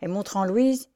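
usual speaking voice

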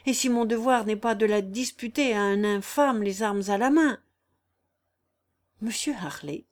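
usual speaking voice

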